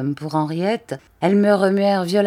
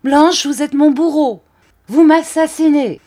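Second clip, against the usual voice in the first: higher, loud raging shout; furious accusation; 10 semitones higher, 9 dB louder than usual. A narrator's reading. The count accusing his wife Blanche, shouting higher and louder. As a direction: scream the blame at her. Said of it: "s'écria-t-il".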